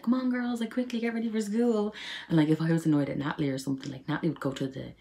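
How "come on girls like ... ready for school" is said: high, loud, hurried mum voice; mum hurrying. Retold or imitated. Mum hurrying the girls for school, high and loud. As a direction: chivvy the girls like a rushed mother. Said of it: "Mum would be like".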